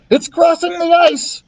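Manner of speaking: high, alarmed yell. A narrator's reading. Thomas yelling to Jack as the dinosaur crosses the ice. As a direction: shout the warning at full pitch. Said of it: "yelled Thomas".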